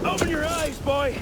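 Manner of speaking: forceful high shout. Acted. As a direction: shake him awake with your voice. Belek urging Arno forcefully.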